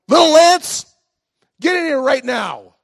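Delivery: high, loud scolding shout; mad grandma yell. Retold or imitated. Grandma calls Lance in from the ditch, mad yell. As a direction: holler for the child as a cross grandma. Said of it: "she's mad".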